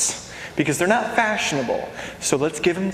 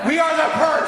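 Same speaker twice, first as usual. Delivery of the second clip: shouted; 7 semitones higher than usual. Real, not acted. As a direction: proclaim it like a slogan. Shouted repeat.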